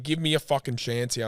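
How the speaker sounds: ordinary talk, nothing marked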